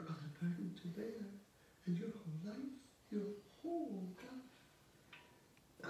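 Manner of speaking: high, drawn-out anguished wail; anguished lament. Acted. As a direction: draw the words out high in anguish. Sonia anguished over his burden.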